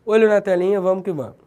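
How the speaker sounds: ordinary talk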